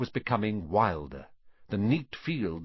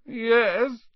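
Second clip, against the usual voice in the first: high, woeful little voice; forlorn, pitched well up; miserable; 12 semitones higher, 6 dB louder than usual. A narrator's reading. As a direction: answer as a miserable boy. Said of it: "said the boy miserably".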